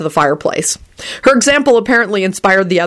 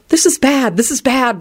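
higher, mildly panicked repetition; frantic thought; 6 semitones higher than usual. A narrator's reading. Rosie to herself as Quentin rages, frantic. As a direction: fret it anxiously. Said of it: "Rosie thought frantically".

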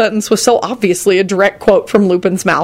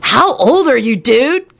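higher-pitched blurted question; amazed kid bursting out; 6 semitones higher, slower than usual. Acted. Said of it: "Kenny burst out".

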